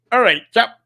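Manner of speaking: cheery chap greeting, hammed-up; mock impression. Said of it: "that's not how he speaks".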